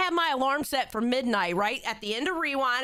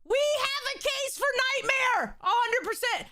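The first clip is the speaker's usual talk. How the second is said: excited shouting; 9 semitones higher than usual. Real, not acted. Reading chat to the co-host, excited high shouting. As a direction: hype it up like a verdict. Agreeing loudly with chat comment.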